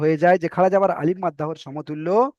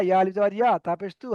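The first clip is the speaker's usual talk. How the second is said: ordinary delivery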